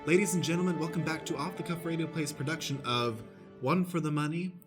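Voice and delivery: usual speaking voice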